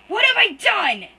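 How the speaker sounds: high, loud, slow anguished scream. Acted. Guilty brother screams to no one, loud, high, slow. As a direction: scream it to the empty room. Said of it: "he screams to no one".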